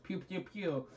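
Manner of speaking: light cartoon laser shots; imitated gunfire. Guy fires his chest gun, imitated gunfire. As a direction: toss off quick toy-gun noises.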